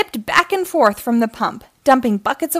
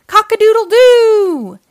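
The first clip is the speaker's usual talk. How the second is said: full-voiced storybook cock-a-doodle-doo; rooster crow; 12 semitones higher, 9 dB louder than usual. A narrator's reading. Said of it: "he crowed".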